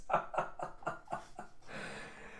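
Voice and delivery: long breathy laugh; helpless laugh trailing off; laughter. Real, not acted. Reader amused by the squirrel scene, long laugh.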